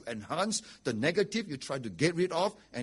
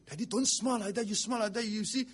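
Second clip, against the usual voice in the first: high, loud mock scolding from the kids; high-pitched, insistent retold plea; protesting children; 10 semitones higher, 6 dB louder than usual. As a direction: imitate children urgently warning their dad. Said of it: "Then my children will say".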